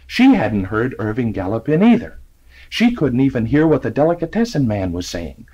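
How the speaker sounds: usual speaking voice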